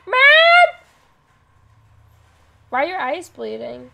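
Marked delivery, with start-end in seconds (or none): 0.1-0.7 high loud call for mom; scared kid calling mom; frightened call. Acted. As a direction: call out into the empty house. Calling out in scary game.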